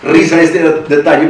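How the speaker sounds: hablar con una risa leve; amused, laughing slightly into the words; risa real. Real, not acted. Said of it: "me da risa". (con risa divertida)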